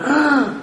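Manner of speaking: sharp high mock gasp. Patient gasping in air for the doctor. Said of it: "Breathe in".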